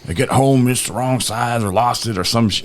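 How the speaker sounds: a hint of a complaining old man; rambling old guy. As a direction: whine about the bolt like an old-timer. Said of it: "he's like".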